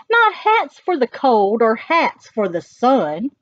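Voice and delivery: sing-song it for the little ones; animated read-aloud of the dog's rhymes; reading to children. Acted. Reading rhyming text.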